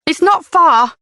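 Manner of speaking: high, defensive retort. A narrator's reading. Jenny defending herself to Leanne, defensive. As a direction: push back quickly. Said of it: "Jenny said defensively".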